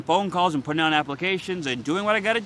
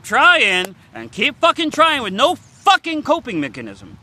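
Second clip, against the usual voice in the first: frustrated outburst; 6 semitones higher, 6 dB louder than usual. Real, not acted. Venting frustration about life.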